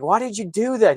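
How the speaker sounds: high, loud retold protest; freaking out. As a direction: imitate a shocked, upset demand. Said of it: "He's freaking out".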